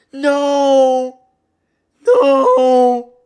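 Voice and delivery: high, loud despairing screams of no; anguished screaming. Real, not acted. Favorite fighter taps out.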